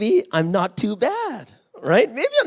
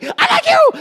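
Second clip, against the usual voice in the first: much higher and louder, blurted out; eager confession; 6 dB louder than usual. Retold or imitated. Guy imagining both confessing at once, eager and high. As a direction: squeal it out with eager hope.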